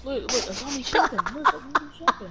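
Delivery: loud, laughing call-out. Real, not acted. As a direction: laugh through the words. Laughing at zombie shopping.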